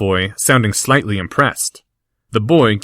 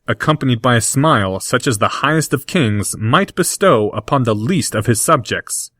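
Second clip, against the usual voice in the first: ordinary delivery, nothing marked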